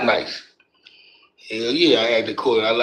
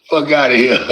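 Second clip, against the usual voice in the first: laughing in disbelief; amused laugh at a stranger. Real, not acted. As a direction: bursts out laughing at the name. Laughing at a viewer's name.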